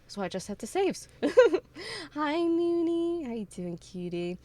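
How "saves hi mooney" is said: high, loud, stretched hello; excited greeting. Real, not acted. Streamer says hi to a viewer, excited drawn-out call. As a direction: sing out the greeting high and slowly.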